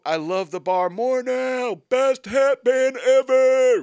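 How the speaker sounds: high, slow, very loud mock fan; gushing quote at full volume; hammed-up enthusiastic shout. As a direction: belt it out like an overexcited fan.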